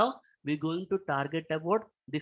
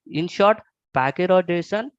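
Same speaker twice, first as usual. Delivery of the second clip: clearly louder, projecting to be heard; 2 semitones higher, 9 dB louder than usual. Real, not acted. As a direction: speak up for the room. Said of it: "is it better now".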